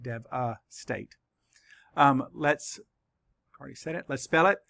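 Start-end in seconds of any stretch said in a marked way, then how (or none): 0.0-1.1 slow, quiet Dev-a-state split into parts; syllable by syllable. Shown as a demonstration. Teacher correcting her syllable count, slow and quiet. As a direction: softly redo Dev-a-state beat by beat. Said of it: "I need to fix that".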